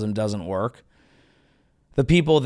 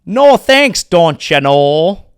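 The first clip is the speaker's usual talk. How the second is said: hammed-up Upper Midwest refusal; Minnesota accent; 2 semitones higher, 9 dB louder, much slower than usual. Comic mock Minnesotan people refusing politely.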